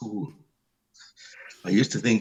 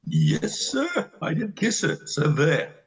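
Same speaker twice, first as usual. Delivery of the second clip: defiant taunt; 7 semitones higher, slower than usual. Acted. Astrov defiant about the kiss.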